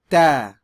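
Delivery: ta with a clean t, no puff of air, said loud; ta said the right way, no breath; unaspirated ta. Shown as a demonstration. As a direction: model ta without aspiration. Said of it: "Repeat after me again".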